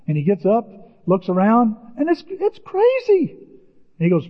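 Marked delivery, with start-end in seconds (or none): 2.0-3.4 amazed. Real, not acted. Preacher marvels at the calm.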